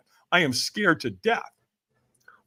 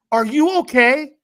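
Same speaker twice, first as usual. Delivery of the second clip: higher, slower caring question; concerned stranger; 9 semitones higher, 8 dB louder than usual. Retold or imitated. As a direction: gently ask if he's okay.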